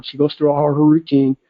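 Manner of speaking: ordinary talk, nothing marked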